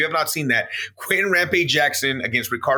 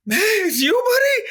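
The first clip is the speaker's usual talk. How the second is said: crying shout. Retold or imitated. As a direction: wail it like a heartbroken brute. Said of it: "And the guy's like".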